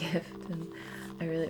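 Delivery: ordinary talk, nothing marked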